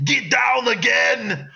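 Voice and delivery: high, slow shouted command; action movie shout. Pretend. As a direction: yell it like an action hero. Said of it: "pull a little Pablo Francisco".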